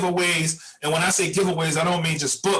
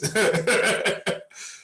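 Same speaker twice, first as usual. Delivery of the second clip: rising chuckle; 5 semitones higher than usual. Real, not acted. Laughs at fussing five hours.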